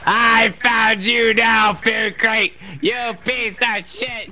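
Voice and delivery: low, slow mock-villain gloat; menacing gloat. Player cornering the food crate, low menacing gloat. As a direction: drop the pitch and draw out the threat.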